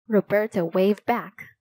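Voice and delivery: ordinary delivery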